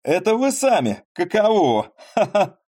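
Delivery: high, loud delighted exclamation with a laugh; excited cry. Acted. Цветков greets Поль on the coachman's box, delighted. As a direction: shout out in happy surprise. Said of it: "он вскрикнул".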